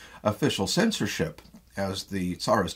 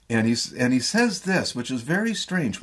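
ordinary talk, nothing marked

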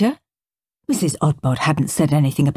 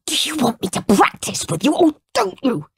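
hissing; 8 semitones higher than usual. A narrator's reading. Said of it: "hissed Henry".

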